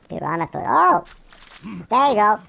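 normal talking voice

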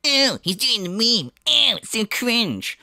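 high, whiny mock complaint; triggered Phil voice. Mimicking the streamer mocking people in chat, high whiny jeer. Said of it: "And again, he did the voice".

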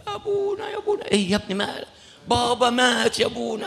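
a son rushing in with bad news; young man calling. Son calls out, father died. (الشاب ينادي الكاهن بصوت عالٍ ليخبره بموت أبيه)

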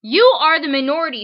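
loud, fast mock yell; all-caps shout. Reading the all-caps letter to the audience, loud mock yell. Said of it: "This letter is in all caps".

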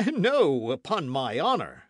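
loud, high protesting outburst; emphatic guardsman's exclamation; indignant cry. A narrator's reading. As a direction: cry out your denial, hitting the first word hard. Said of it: "cried his Majesty's guardsman".

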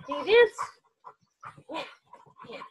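high, loud delighted cheer; thrilled squeal at the dog; excited cheer. Real, not acted.

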